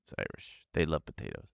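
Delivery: very soft, low self-correction; sheepish aside. Real, not acted. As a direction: trail into a near-inaudible apology to yourself. Backing off a wrong stereotype aloud.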